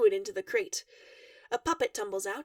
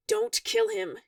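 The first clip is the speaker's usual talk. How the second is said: biting, higher demand; sharp snap; 8 semitones higher, 3 dB louder, slower than usual. A narrator's reading. Dave stepping between Durka and the stranger, snapping. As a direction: snap it out to stop him. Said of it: "he snaps".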